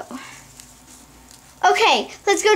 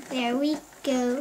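ordinary delivery

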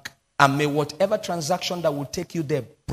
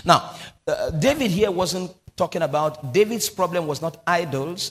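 ordinary delivery

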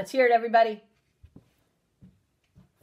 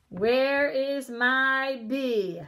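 slow searching "Where is my B?"; drawn-out searching; 3 semitones higher, much slower than usual. Shown as a demonstration. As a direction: stretch it out while looking. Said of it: "searching slowly on screen".

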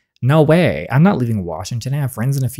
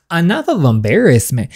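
higher, indignant repeat; indignant echo; 4 semitones higher, 4 dB louder than usual. Acted. Friend throws the father's insult back, indignant.

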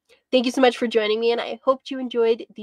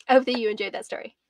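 ordinary delivery

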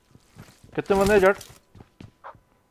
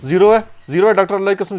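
cover me shout; 7 semitones higher, 8 dB louder, faster than usual. Real, not acted. Urgent call for cover in gunfight.

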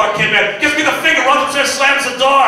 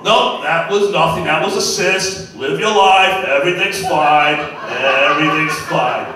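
lower, slow offhand doctor voice; breezy doctor; 8 semitones lower, slower than usual. Retold or imitated. Doctor lightly waving off the patient's cancer scare. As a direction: brush it all off lazily.

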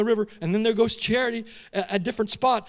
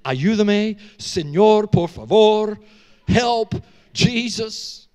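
louder desperate plea in Spanish, drawn out at the end; retold loud cry for help, slowing on Jesus; 2 semitones higher, 6 dB louder, much slower than usual. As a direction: call out to God for rescue. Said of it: "I said help in every language".

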